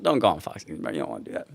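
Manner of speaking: ordinary talk, nothing marked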